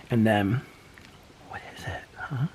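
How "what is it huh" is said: soft unvoiced whisper; whispering to pet. Real, not acted. Whispering a question to the pet. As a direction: barely breathe it to the pet.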